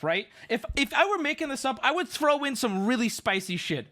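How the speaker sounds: usual speaking voice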